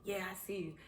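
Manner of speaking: whispered, breathy and secret. Retold or imitated. Answering a passing bird: soft whisper.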